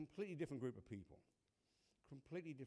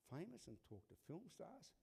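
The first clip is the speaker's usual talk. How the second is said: softer, higher disbelieving echo; quiet baffled repeat under the breath; incredulous to myself; 4 semitones higher, 9 dB quieter than usual. Retold or imitated. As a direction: mutter the echo to yourself in disbelief. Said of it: "saying to myself".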